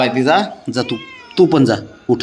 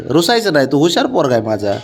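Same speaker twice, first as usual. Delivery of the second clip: ordinary talk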